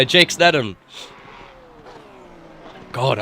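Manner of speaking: ordinary delivery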